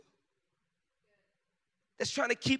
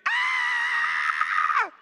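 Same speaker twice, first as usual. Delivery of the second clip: long, drawn-out shriek; imitated scream; 13 semitones lower, 4 dB louder than usual. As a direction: keep screaming like a panicked wife. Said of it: "she's still screaming".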